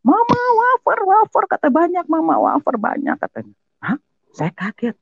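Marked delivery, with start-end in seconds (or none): 0.1-1.7 put-on very high, excited kid's yell; imitated child's shouting. Child telling mother about the wafers, imitated high shouting. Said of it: "anak saya teriak". 1.7-3.2 put-on high kid's call, easing off at the end; imitated child's shouting. Child reporting lots of wafers to mother, imitated high shouting. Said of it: "anak saya teriak".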